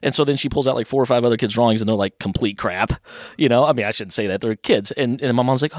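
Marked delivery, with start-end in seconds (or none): none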